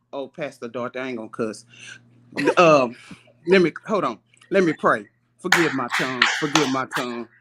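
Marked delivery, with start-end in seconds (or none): none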